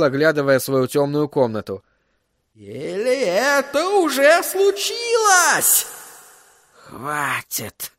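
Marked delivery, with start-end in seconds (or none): 2.5-5.9 high, drawn-out cry of alarm; climbing, stretched, agitated question; alarmed outcry. A narrator's reading. Flagg jumps up in alarmed question. 6.7-7.9 soft, exhaled command; hushed exhalation of enough. A narrator's reading. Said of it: "выдохнул он".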